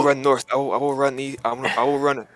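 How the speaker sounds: urgent orders. Acted. Player's character splits the party.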